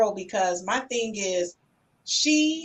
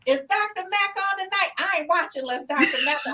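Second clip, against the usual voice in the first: imitating her friend; 6 semitones higher than usual. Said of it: "my best friend since college".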